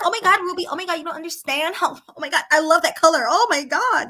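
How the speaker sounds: put-on lightly done Valley Girl gush; Valley Girl impression. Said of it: "that's how you talk".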